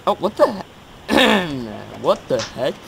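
high, loud startled voice crack. Real, not acted. Gamer baffled mid-game, voice cracking. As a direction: voice cracking upward on a startled outburst. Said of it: "Nice voice crack".

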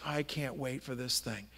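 low, soft retold frustration; quiet frustration. Worker confiding in a customer, quietly frustrated. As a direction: share the frustration under your breath. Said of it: "he began to share his frustration".

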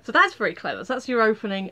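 normal talking voice

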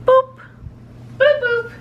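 high playful boops; goofy sing-song boop noises; silly clown. Pretend. Silly boop sounds to toddler.

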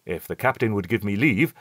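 ordinary delivery, nothing marked

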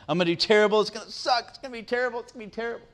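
put-on high-pitched kid whining, fading away as it repeats; mock frustrated frenzy. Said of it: "he just works himself into a frenzy".

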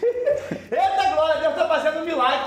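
slow, laughing Eita glória; drawn-out joyful laugh; laughing praise. Real, not acted. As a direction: laugh through it with delight. Joyful exclamation mid-sermon.

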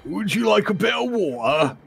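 high-pitched, exaggerated English accent. Shown as a demonstration. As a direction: ham up a British accent in a high voice. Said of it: "my best English accent ever".

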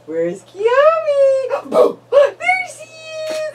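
slow high excited peekaboo. Real, not acted. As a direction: stretch it out with delight. Peekaboo game.

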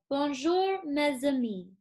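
bright French demo of Bonjour, mes amis; French greeting. Shown as a demonstration. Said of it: "to say hello in French, I would say".

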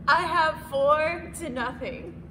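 slow, high, laughing hard; breaking up with laughter. Real, not acted. Laughing while counting score.

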